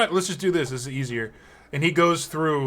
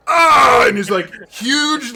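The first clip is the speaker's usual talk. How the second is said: huge roaring "Ah!", much higher and louder; joyful roar; 10 semitones higher, 10 dB louder than usual. Acted. Giant tiefling roaring a happy greeting at the party. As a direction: let out a big delighted bellow. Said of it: "he goes".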